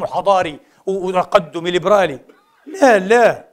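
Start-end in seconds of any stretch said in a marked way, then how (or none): 2.7-3.5 laughing no. Real, not acted. Preacher laughing off the critics' reading, laughing. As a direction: laugh through a mocking no, no.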